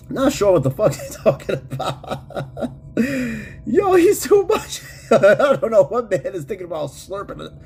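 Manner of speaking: laughing through the words, pitch jumping; real laughter. Real, not acted. Streamer baffled by chatter's slurping remark, helpless laughing. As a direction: talk while cracking up in disbelief. Cracking up at the chat message.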